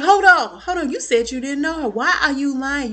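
high, loud accusing "hold on"; mock confrontation. [name] catching Zach in a lie, high and loud. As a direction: call him out on the lie.

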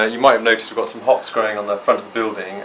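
ordinary talk, nothing marked

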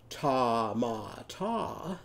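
"Ta, ma, ta" as tah, mah, tah; tomato with ah. Shown as a demonstration. Teacher changes tomato for Ollie Ostrich, ah in each syllable. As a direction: draw out an open ah in each of "Ta, ma, ta". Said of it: "a tomato is gonna do what".

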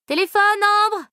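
high, slow, drawn-out call across the room. Acted. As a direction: shout it across the flat. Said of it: "cria Rosalie".

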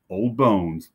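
ordinary delivery

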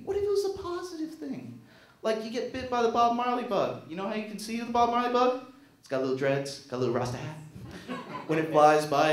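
usual speaking voice

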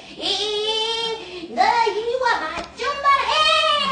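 high, loud, straining threat; mock-furious grandpa swinging and stumbling; hammed-up effortful scolding; clumsy angry grandpa. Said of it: "할아버지 막 넘어지고 하는 것을".

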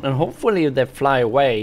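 ordinary talk, nothing marked

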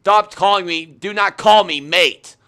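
drawn-out, exasperated telling-off; indignant rebuke. Real, not acted. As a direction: spell it out slowly, fed up. Rebukes caller for calling him mate.

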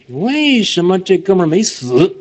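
slow, offhand street-talk rewording; teacher recasts an exam question in slang; casual slangy paraphrase. Shown as a demonstration. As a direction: toss the question off like chatting with a buddy. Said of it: "就这意思".

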